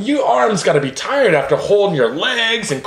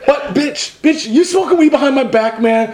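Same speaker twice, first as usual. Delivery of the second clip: raised-pitch mock outrage; outraged boyfriend; 7 semitones higher than usual. Boyfriend accusing girl of smoking behind his back, mock outraged. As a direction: accuse her in a high, hammed-up fuss.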